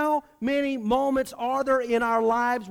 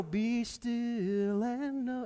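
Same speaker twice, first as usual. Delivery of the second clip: slow, soft and hushed, like a familiar verse; the comforting version, spoken slowly and softly; soft reverent reading; 3 semitones higher, 5 dB quieter, much slower than usual. Shown as a demonstration. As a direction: breathe it gently as a calm promise. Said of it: "It's not".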